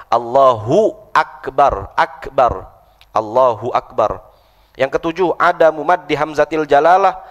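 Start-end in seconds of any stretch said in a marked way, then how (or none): 0.1-4.2 slow "Allahu Akbar", open a in "akbar"; right takbir. Shown as a demonstration. Teacher demonstrating the correct takbir. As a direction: stretch "Allahu Akbar" cleanly. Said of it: "Bukan Allahu Akbar ya".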